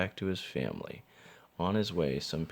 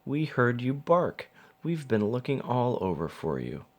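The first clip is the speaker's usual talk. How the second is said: put-on excited children; 6 semitones higher, 4 dB louder than usual. A narrator's reading. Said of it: "they cried".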